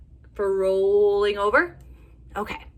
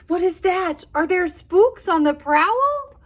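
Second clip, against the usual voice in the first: frightened bear; 11 semitones higher, 8 dB louder, slower than usual. Acted. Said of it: "Bear mutters".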